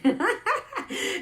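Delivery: high burst of laughter. Real, not acted. Amused at people crying when she talks to them, high laugh. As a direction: laugh out loud.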